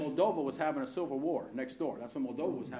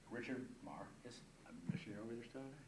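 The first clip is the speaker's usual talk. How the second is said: low, soft reverent testimony; quiet, low wonder; hushed awe; 6 semitones lower, 14 dB quieter than usual. Real, not acted. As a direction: drop right down and let it sink in. Hushed awe closing his testimony.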